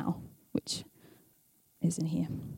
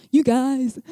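louder, higher mock quote through a laugh; amused, raised-voice echo; 4 semitones higher, 6 dB louder than usual. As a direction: laugh at your own wording as you repeat it. Laughs at her own wording.

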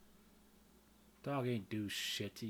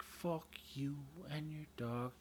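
slow unvoiced whisper; whispered quote; 3 semitones higher, 7 dB quieter, slower than usual. Vlogger whispering the misheard lyric to viewers. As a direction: hiss it slowly under the breath.

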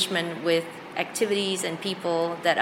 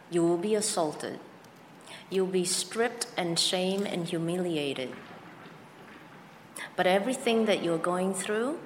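ordinary delivery

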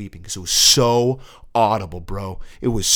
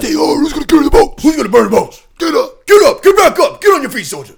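loud high barking coach, pushing hard; drill sergeant yell; 12 dB louder than usual. Retold or imitated. Mocking a tough-love coach yelling at a soldier, loud barked orders. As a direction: shout him to his feet like a drill instructor. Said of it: "like David Goggins style".

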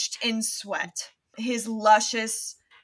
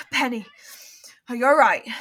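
out of breath; 7 dB louder, faster than usual. Acted. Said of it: "He is out of breath".

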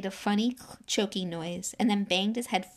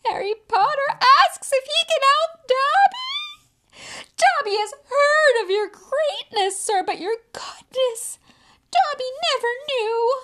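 high, loud, tearful elf voice; a house-elf sobbing out his thanks; crying, overwhelmed with gratitude; wailing gratitude; 11 dB louder than usual. A narrator's reading. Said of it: "dissolved again into wails of gratitude".

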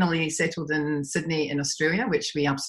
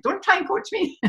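annoyed; 13 semitones higher than usual. Retold or imitated. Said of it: "I'm like".

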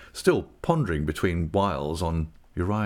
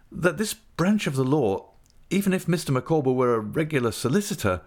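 raised pitch, careful explaining; Traddles, explanatory; 8 semitones higher than usual. A narrator's reading. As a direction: lay out the facts carefully, slightly higher.

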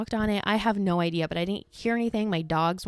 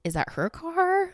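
high, slow puzzled question; suspicious wondering; 11 semitones higher, slower than usual. Retold or imitated. Telling about the strange car, a high puzzled question. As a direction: squint at it in disbelief.

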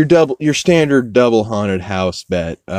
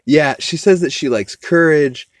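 ordinary talk

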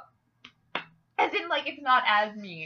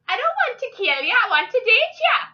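mock Norwegian accent; 14 semitones higher, 8 dB louder than usual. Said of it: "it goes Irish when I try to do Norwegian".

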